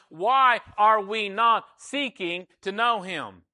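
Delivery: loud, high full-force preaching; impassioned preaching. Real, not acted. Preacher urging congregation to know God, impassioned preaching.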